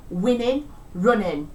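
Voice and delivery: "winning" and "running" said as "winnin', runnin'"; casual "winning, running" ending in a plain N; G dropping. Shown as a demonstration. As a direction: drop the final G of "winning" and "running". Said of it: "omission of the G sound at the end".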